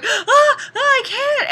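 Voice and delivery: hammed-up damsel who can't break free; mock distressed wail. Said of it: "and she's going".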